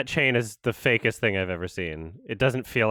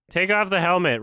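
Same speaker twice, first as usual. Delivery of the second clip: raised, insistent call; urging; 6 semitones higher, 4 dB louder than usual. Real, not acted. Viewer telling the on-screen character what to do, urging. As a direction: urge it firmly at a higher pitch.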